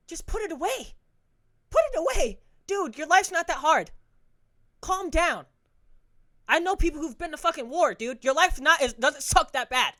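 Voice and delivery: very high, loud angry yelling; furious shout. Real, not acted. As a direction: scream at them to put it away. Ranting at drinking teenagers.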